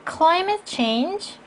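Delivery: weight on the front word "climate", slowly; stress on climate. Shown as a demonstration. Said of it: "명사 앞에 강세 주시고요". ("climate"에 강세를 주어 천천히)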